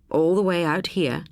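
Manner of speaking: plain reading voice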